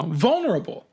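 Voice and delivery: mock the word in disbelief; incredulous echo. A narrator's reading. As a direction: throw it back, offended. Narrator scoffs at teacher's word.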